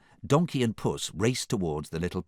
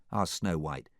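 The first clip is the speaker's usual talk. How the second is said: ordinary delivery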